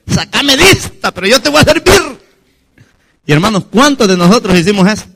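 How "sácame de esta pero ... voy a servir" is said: high, urgent imitated plea; pleading bargain. Detained migrant bargains with the Lord, high urgent pleading. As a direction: beg hard and promise in return. Desperate bargain with God, retold.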